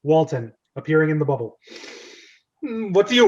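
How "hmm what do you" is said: put-on grumpy old wizard. Walton the crusty wizard.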